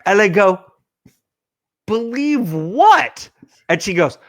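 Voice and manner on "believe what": slow, high baffled question; acted-out confusion, stretched and higher; retold bafflement. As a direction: draw it out high, utterly lost. His baffled reply, retold.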